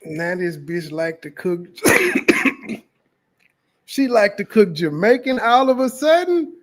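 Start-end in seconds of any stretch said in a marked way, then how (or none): 1.7-2.8 sudden high rasping cough mid-rant; sharp cough. Real, not acted. Reacting to the clip, a sudden rasping cough. 3.8-6.5 higher and louder, fed-up disbelief; exasperated. Real, not acted. Ranting at the woman who suddenly cooks Jamaican, exasperated and loud.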